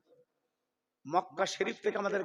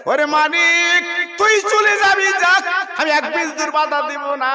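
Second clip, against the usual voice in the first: much higher and louder, shouting the blessing; mother shouts after her departing son; anguished mother's cry; 9 semitones higher, 14 dB louder than usual. Acted.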